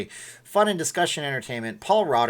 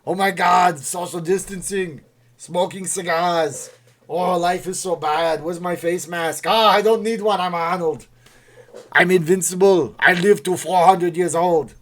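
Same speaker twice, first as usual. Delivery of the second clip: loud, higher-pitched Austrian action-star impression; hammy Austrian bellow, building to invincible bravado; Arnold Schwarzenegger; 4 semitones higher, 6 dB louder than usual. Arnold social distancing in his bathtub, exaggerated impression. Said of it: "Arnold loves his cigars".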